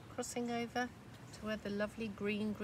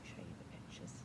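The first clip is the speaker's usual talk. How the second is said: whispering; 14 dB quieter, faster than usual. Real, not acted. Aside to listeners.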